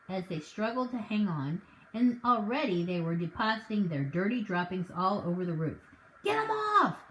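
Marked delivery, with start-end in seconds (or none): 6.2-7.0 much higher, loud cry; panicked shout. Acted. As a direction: yell to save the car. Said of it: "shouted Mister Hazel".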